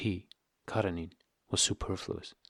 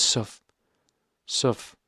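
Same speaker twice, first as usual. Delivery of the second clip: tripping on "suff-, suff-, suffled" in a rush; stumbling over the word; 2 semitones higher, 3 dB louder, much slower than usual. A narrator's reading. Husband ranting too fast, stumbling over the word. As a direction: stammer and land on "suffled" instead of "suffered". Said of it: "speaking so rapidly that he blundered".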